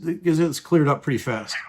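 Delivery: normal talking voice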